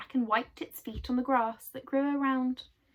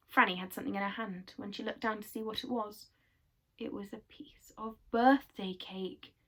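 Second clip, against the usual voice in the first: ordinary delivery, nothing marked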